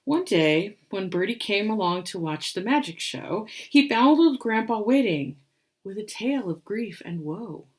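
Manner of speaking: put-on lively read-aloud for children; rhyming story narration. A narrator's reading. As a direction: tell the rhyme with storybook bounce. Reads picture book aloud.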